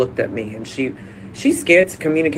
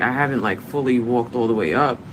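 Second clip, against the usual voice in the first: usual speaking voice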